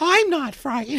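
put-on high, slow, tearful ghost child; too calm creepy doll. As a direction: whimper it like a haunted girl. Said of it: "callback to the Merchant House".

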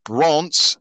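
Reims said as "ronts"; French pronunciation. Shown as a demonstration. Host reading the bottom of the table, Reims as "ronts". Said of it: "not Reims".

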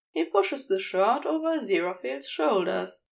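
ordinary delivery

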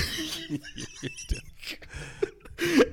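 high, airless laugh close to tears; wheezing laugh, almost crying; breathless crying laughter. Real, not acted. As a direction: laugh until you can't breathe. Laughing until near tears.

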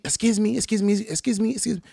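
high, rapid-fire excuse-me's; rude demanding customer. Retold or imitated. Rude diner pestering the waiters, high and insistent. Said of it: "she was literally so rude".

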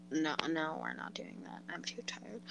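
soft tired whisper. Real, not acted. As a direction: breathe it out, worn out.

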